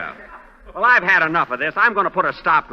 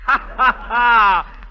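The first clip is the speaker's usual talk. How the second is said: mock laugh; 5 semitones higher, 3 dB louder, much slower than usual. Mocking after a near miss.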